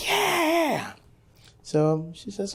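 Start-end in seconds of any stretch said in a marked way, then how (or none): 0.1-0.9 high whooping cheer; crowd cheer. Retold or imitated. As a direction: cheer like the whole audience. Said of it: "they say".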